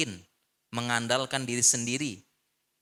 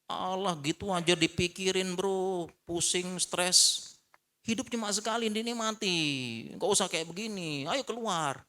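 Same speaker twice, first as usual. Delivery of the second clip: dismissive mocking friend; 6 semitones higher than usual. Menirukan support system yang meremehkan.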